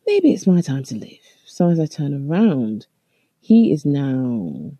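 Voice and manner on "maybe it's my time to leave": inner voice. Retold or imitated. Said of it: "I was like".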